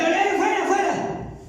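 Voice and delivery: mock showy church exorcist; showy exorcism. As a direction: ham it up like a showman casting out demons. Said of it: "hagan más espectáculo".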